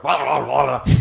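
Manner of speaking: quick garbled mock barking; lightly voiced yapping dog; imitated dog barking. Owner imitates his dog at a passerby, mock barking. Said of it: "the dog's like".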